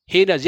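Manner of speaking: हेनस as HAY-nas, two syllables; silent i. Shown as a demonstration. Teacher showing correct reading, हेनस as HAY-nas with silent i. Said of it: "यही मेरा तरीका है".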